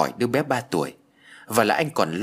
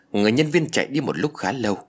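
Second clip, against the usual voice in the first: usual speaking voice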